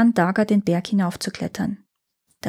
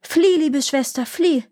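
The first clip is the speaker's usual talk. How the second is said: higher urgent call to flee; 7 semitones higher than usual. A narrator's reading. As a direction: warn her away, urgently. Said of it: "rief ihr zu".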